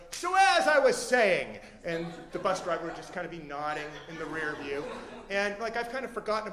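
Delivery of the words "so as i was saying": loud, high, drawn-out launch back in; booming resumption. Retold or imitated. Man resuming his story as the bus takes off, booming. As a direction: seize the floor again, loudly and slowly. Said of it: "he'd go".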